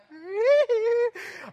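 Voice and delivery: high, loud mock squeal; gleeful squeal. Pastor going down the slide, gleeful squeal. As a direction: squeal like you're flying down a slide.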